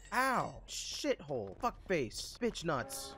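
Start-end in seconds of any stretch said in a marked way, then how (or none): none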